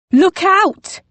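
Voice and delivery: high, loud warning scream. Acted. Missus Barclay screaming to warn Poppy. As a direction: scream a warning across the street. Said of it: "Missus Barclay's scream".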